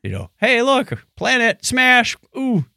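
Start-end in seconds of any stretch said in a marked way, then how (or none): none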